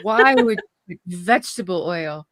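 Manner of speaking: normal talking voice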